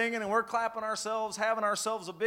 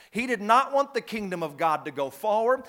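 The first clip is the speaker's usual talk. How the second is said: ordinary delivery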